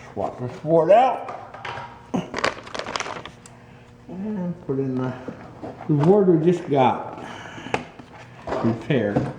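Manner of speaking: talking around a flashlight in mouth; words muffled by a flashlight held in teeth. Real, not acted. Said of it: "with a flashlight in my mouth".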